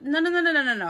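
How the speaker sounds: rapid-fire string of refusals; retold firm refusal. To Kay saying it's good, firm retold refusal. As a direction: cut the friend off, starting high. Overruling injured friend's brush-off.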